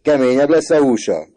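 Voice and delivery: loud, high exclamation; hearty shout. A narrator's reading. Father joking about his son's beatings, hearty shout. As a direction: call it out heartily. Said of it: "kiáltotta".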